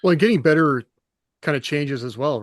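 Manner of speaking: ordinary delivery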